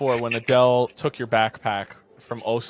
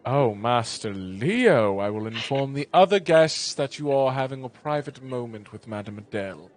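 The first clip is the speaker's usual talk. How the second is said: knowing servant; 2 semitones lower than usual. Acted. Servant discreetly promising Leo privacy, knowing. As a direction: draw out the name with knowing discretion.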